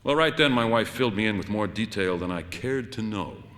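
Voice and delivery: usual speaking voice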